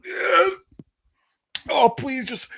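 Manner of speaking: high strained begging; pleading whimper. Retold or imitated. Recruit dry heaving in the gas, high pleading whine. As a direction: whine to be let out. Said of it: "sitting there like".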